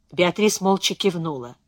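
ordinary delivery, nothing marked

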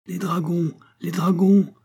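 high-pitched cry of alarm; crowd cry. A narrator's reading. Crowd warning that dragoons are coming, high-pitched cry. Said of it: "crièrent plusieurs voix".

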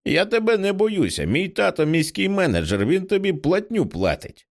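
lightly voiced high cheeky kid; high smug little boy; bratty child. A narrator's reading. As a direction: taunt like a spoiled brat. Bratty child taunts adult.

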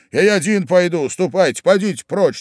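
usual speaking voice

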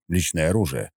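ordinary delivery

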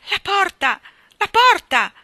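high, loud cry of alarm; two sharp, loud exclamations; startled cry. A narrator's reading. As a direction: cry it out in sudden shock, pitched high. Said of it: "Un grido soffocato si sprigionò".

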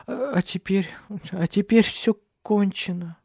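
high, slow, broken sobbing voice; tearful lament. A narrator's reading. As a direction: grieve for the lost child. Weeping after losing the child.

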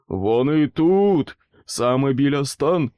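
loud, slow desperate yell; the trapped boot yelling to the searchers; wailing yell. A narrator's reading. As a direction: bellow it to raise the alarm. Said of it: "його волання заглушив".

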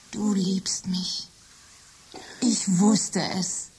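whispered, very slow rapture; slow blissful whisper. Acted. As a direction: savour each word in a whisper. Machine delighted by touch. (langsames, verzücktes Flüstern)

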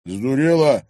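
loud, surly jibe. Acted. Thug annoyed at girl not knowing him, surly. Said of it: "хмуро взглянул на неё брюнет".

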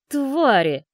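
put-on furious drunken outburst; angry shout. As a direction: spit out the curse, loud. Drunk man cursing ex-wife.

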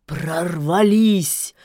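slow, loud angry spit; angrily spat. Acted. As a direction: spit the word out in anger. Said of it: "гневно выплюнул серый".

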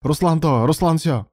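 high, exasperated outburst; exploding in anger. A narrator's reading. Alan is sick of hearing about Ruslan, exasperated. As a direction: burst out, fed up. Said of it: "окончательно взорвался Алан".

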